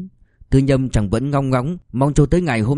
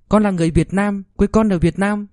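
put-on high child's voice, proudly repeating; a child saying the lesson back to mom; little boy reciting; 8 semitones higher than usual. Child repeats corrected answer.

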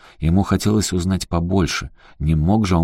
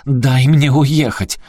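громкий крик, намного выше; выкрикни в слезах; крик сквозь слёзы; 8 semitones higher, 7 dB louder, much slower than usual. Acted. Аляска в слезах кричит. (Аляска кричит Эрику сквозь слёзы)

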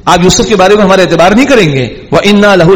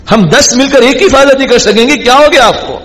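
much higher, hammed-up indignation; brothers' indignant tone; 8 semitones higher than usual. Brothers insisting to Jacob that ten can guard one, hammed-up indignation. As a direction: act out the injured pride of grown sons. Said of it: "یہ بھی tone ہے ہمارے یہاں کی".

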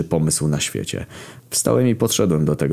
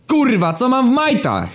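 screaming. Retold or imitated. Narrator yells at silent Kamil across the corridor, screaming. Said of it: "wydarłem się na cały korytarz".

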